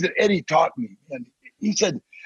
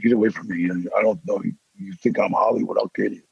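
much lower, hushed threat; low menacing threat; 8 semitones lower, faster than usual. Retold or imitated. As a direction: warn him off in a low, dangerous voice. Threatening someone on set.

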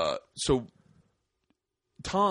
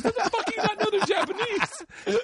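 very high, incredulous scolding; retold outrage. Friend scolding comic for his mistake, mock outrage. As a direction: act out an appalled friend, voice way up.

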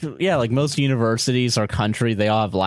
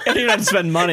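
laughing; 12 semitones higher, much faster than usual. Real, not acted. Laughing at joke.

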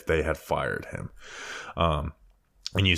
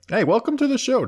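high silly voice. Pretend. High silly greeting to supporter.

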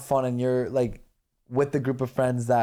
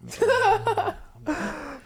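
high squealing laugh; high burst of laughter. Real, not acted. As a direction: break into a shrieking laugh. Laughing at butt joke.

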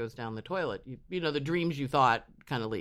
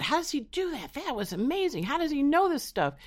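much higher, excited wonder; amazed gush; 14 semitones higher, 3 dB louder than usual. Retold or imitated. To her friends, gushing with high amazement. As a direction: gush with wide-eyed wonder.

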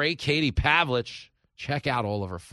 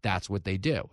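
usual speaking voice

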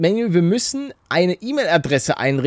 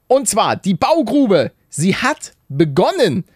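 announcer voice; 6 semitones higher than usual. Pretend. Announces the house update segment.

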